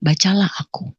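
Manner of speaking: hushed low command, retold; quoted mysterious voice. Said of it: "Gitu katanya".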